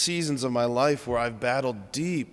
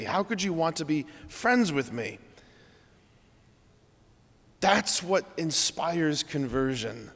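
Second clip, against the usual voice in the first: ordinary talk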